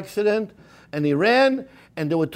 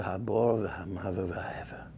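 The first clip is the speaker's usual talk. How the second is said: fading whisper; 8 semitones lower, 10 dB quieter than usual. Acted. Rabbi closing the lament, fading into a whisper. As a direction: voice sinks to a breathless whisper.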